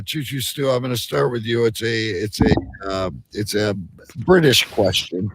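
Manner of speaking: normal talking voice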